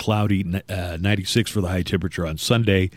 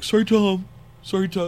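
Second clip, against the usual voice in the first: high, meek mumbled apology; squeaky sheepish sorry; mock apology; 11 semitones higher than usual. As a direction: cringe and apologize to Tom. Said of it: "everybody's like".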